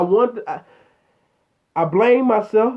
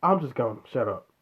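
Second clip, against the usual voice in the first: much softer, low resigned aside; resigned mutter; 9 semitones lower, 9 dB quieter than usual. Real, not acted. Vlogger cutting his own rant short, soft and low. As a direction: give up and trail off.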